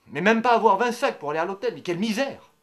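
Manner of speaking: loud, high-pitched tirade; outraged rant. Acted. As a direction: explode in scornful outrage. Rant about lovers peaks in outrage.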